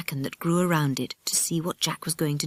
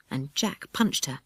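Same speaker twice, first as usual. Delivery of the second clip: ordinary delivery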